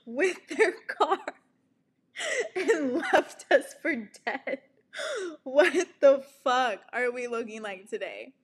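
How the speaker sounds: mock despair at the mirror; laughing self-roast.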